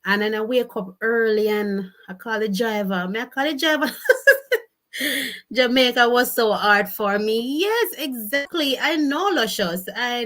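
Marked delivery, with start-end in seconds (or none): 4.0-4.6 short shrieking laugh; quick laugh at her own joke; high laugh. Real, not acted. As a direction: burst out laughing.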